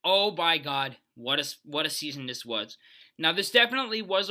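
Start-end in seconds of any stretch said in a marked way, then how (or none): none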